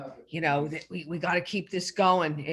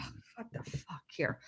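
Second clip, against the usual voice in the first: whispered; 12 dB quieter than usual. Retold or imitated. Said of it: "I'm like".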